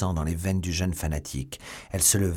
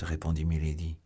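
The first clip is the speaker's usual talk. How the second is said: usual speaking voice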